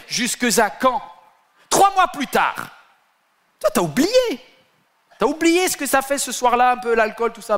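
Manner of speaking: preaching at full force; fiery preaching. Real, not acted. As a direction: hammer the question at the crowd. Preacher rebuking the congregation.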